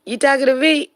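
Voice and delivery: mock tough dog voice. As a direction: push the challenge up and out. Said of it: "Shook said".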